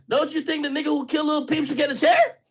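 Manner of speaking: usual speaking voice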